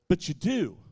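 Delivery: ordinary talk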